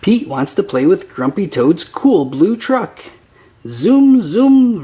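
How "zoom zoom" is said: loud, high car noises; playful vroom sounds; excited zoom. Acted. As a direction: zoom it like a kid racing a toy truck. Playing with toy truck.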